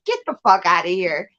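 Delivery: normal talking voice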